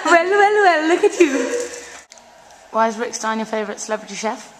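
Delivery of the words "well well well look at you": teasing. Pretend. Teasing a blushing friend.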